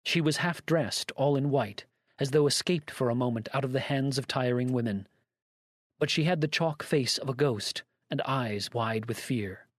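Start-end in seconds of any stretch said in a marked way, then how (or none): none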